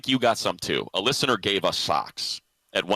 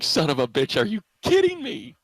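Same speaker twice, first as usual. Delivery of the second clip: mock dismay; 8 semitones higher than usual. Recalling zipper shock.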